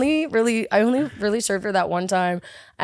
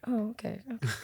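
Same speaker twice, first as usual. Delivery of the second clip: very soft, small acknowledgement; muted reply; 14 dB quieter than usual. Retold or imitated. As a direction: say it under your voice. Owner's muted reply acted out.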